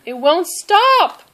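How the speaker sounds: high, slow alarmed shout. A narrator's reading. Ted shouting as the tractor races downhill. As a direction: yell it out as the tractor runs away. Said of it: "shouts Ted".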